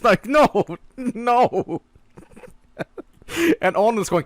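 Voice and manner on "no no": high mock-crying plea; comically tearful protest, pitched up; imitated crying brother. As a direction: whimper it like an upset kid, half laughing. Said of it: "my brother's crying".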